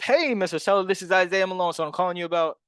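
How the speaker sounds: bright-pitched, upbeat phone opener; high-energy enthusiasm. Shown as a demonstration. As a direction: burst in with a high, eager greeting. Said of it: "hit 'em with the enthusiasm".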